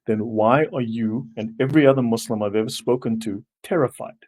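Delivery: usual speaking voice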